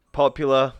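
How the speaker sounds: usual speaking voice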